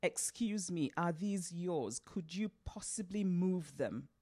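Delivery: mannered, over-careful British pronunciation; crisp BBC English, each word placed; Mister Abo voice. Acted. Ifemelu putting a careless stranger in his place, in careful BBC English. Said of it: "So her Mister Abo voice emerged".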